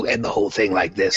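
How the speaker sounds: normal talking voice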